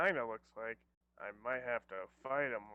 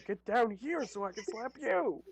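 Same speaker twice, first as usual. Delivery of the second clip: high, hammed-up play-fighting yell; higher mock threat; 8 semitones higher, 4 dB louder than usual. Player mocking the slapping contest, high mock yell. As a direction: answer the joking challenge in a raised, high voice.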